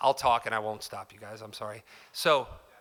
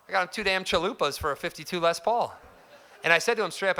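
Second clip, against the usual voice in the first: laughs through the words, louder and higher; chuckling at the absurd trade; laughing while telling; 6 semitones higher, 5 dB louder than usual. Real, not acted. Laughing at the cheap deal.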